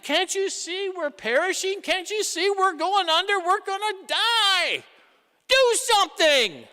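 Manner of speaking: high, loud panicky cry for help; frightened disciples crying out as they sink; panicked plea. Retold or imitated. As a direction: beg like the boat is sinking.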